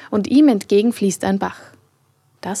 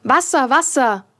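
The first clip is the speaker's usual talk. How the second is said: shouting crowd; 5 semitones higher than usual. A narrator's reading. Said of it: "Die Leute schrien".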